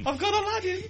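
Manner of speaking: camp. Acted. Camp innuendo, higher voice.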